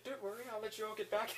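light, high, fast excitable earl voice, loosely imitated; Sir Robert Narmby imitation. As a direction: gush it out like a giddy, eccentric aristocrat. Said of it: "it's like".

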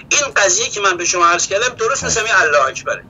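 normal talking voice

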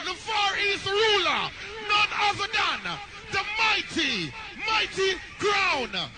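booming MC hype introduction; grand entrance announcement. Acted. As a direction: announce the champion like a stage host. MC introduces the next sound.

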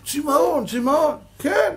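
high loud, near tears; emotional cry. Real, not acted. Preacher overcome with feeling about the penitent's thirst, high and tearful.

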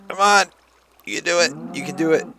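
high, eager encouragement; root for the cow to make it; high cheering on. Real, not acted.

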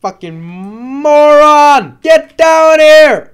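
slow, loud, high yelling; angry yell. Real, not acted. Player screaming at the dragon to land, slow loud yell.

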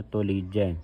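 ਤੁਲੀਜੈ with the u rounded toward "o", "to-lee-jai"; linked short-u pronunciation. Shown as a demonstration. Teacher shows the aunkar on tatta, ਤੁਲੀਜੈ as "to-leejai". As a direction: round the first syllable of ਤੁਲੀਜੈ. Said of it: "ਤੱਤੇ ਔਂਕੜ ਜਿਹੜਾ ਸੰਬੰਧਕ ਲਾਉਣਾ ਹੈ".

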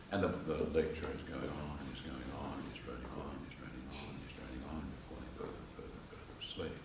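sleepy fade from mumble to whisper; drifting off to sleep. Pretend. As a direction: let the voice die away into sleep. Acting out dozing off in lecture.

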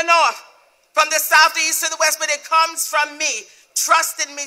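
high, loud preaching at full force; commanding divine voice. Retold or imitated. God tells a believer not to look to man, loud and forceful. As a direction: ring out each phrase as God's command.

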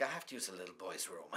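quick low aside. Acted. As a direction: mutter it quickly under your breath. Interviewer's rushed aside about bathroom.

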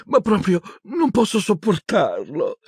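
high-pitched tearful wail; sobbing girl. A narrator's reading. Hermione pretending to break down, high tearful voice. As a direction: whimper it high, feigning despair. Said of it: "singhiozzasse disperata".

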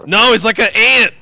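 high, loud exasperated shout; loud exclamation. Real, not acted. Frustrated at game character.

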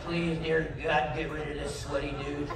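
a mock whispered prayer to God. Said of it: "Nicole is deep in the middle of prayer".

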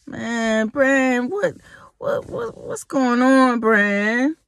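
high, stammering imitation of a baffled man; mock deflated whine. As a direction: imitate him pleading in a high, wheedling voice. Imitates his anger suddenly dissipating.

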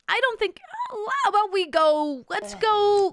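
imitated instructor's upbeat pleading; coaxing surf instructor. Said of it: "he's trying to convince us".

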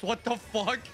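ordinary delivery

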